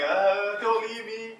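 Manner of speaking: high, hammed-up sobbing plea; mock crying plea. Movie guy begging the girl in the car, mock sobbing plea. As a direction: beg her to stay in a mock cry. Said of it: "he's crying".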